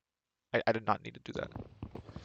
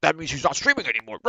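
higher, louder cartoon program voice; software voice; 5 semitones higher, 7 dB louder than usual. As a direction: voice the stream app like a character. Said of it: "O B S thinks".

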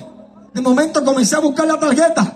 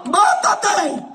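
drawn-out, high-pitched devil's cry; devil's command; 3 semitones higher than usual. Retold or imitated. Devil to a man near the nineteenth-floor drop, commanding. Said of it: "la voz del diablo me decía".

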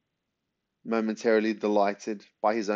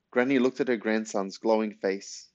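ordinary delivery, nothing marked

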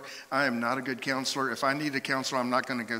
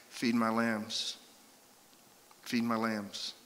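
slow, soft, lower commissioning; gentle charge; 3 semitones lower, 4 dB quieter, slower than usual. Acted. Jesus to Peter, entrusting lambs, gentle. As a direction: let it settle tenderly, unhurried.

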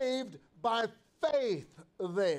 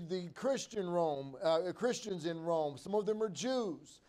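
normal talking voice

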